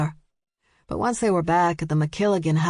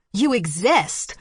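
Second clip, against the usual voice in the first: barking; 3 semitones higher, slower than usual. A narrator's reading. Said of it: "Stevie barked".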